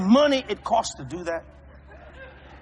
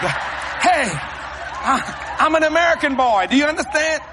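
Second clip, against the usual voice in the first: loud, higher indignant protest; mock protest shout; 7 semitones higher, 4 dB louder than usual. Preacher protesting, I'm an American boy, loudly.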